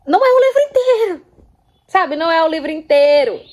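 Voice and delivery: exasperated outburst to viewers; indignant emphasis. Real, not acted. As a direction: hit back at the objection, fired up. Indignant rebuttal to readers.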